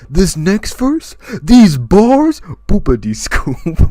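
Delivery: loud, high, drawn-out rap hype; slow, shouted parody of a rap verse. Retold or imitated. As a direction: build it loud and high, then drop into nonsense. Imitating [name]'s song lyric.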